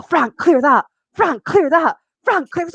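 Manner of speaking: high, eager repeated orders; eager commanding. Retold or imitated. Telling a fire dragon what to burn, eager commanding. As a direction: call each order out, pitch climbing.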